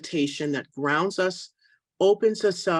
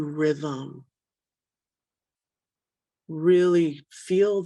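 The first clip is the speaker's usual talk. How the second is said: unhurried, phrase by phrase, leaving room to breathe; guided meditation. Acted. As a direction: guide listeners gently into breath and imagery.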